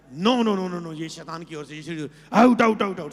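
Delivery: loud, forceful no and out; rebuking shout. Acted. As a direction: cast it out with a shout. Preacher acts rejecting the devil. (शैतान को डांटते हुए ज़ोर से चिल्लाना)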